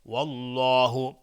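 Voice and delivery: والله slow and loud, with rounded lips; heavy Allah. Shown as a demonstration.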